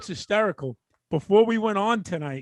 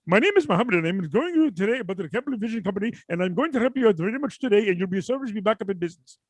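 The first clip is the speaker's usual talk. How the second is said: put-on Indian call-centre patter; Indian accent; faster than usual. Cable company agent explaining the help on offer, Indian accent. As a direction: keep up a running Indian accent. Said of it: "someone from India".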